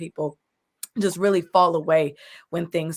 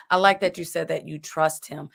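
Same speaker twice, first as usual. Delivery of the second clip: usual speaking voice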